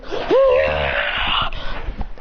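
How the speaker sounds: rough, drawn-out battle yell; strained cry as a blast fires; acted attack cry. Hero cries out while attacking.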